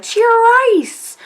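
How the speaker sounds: high, bright cheer; cheery shout. Retold or imitated. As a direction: cheer it like a toast. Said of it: "they're like".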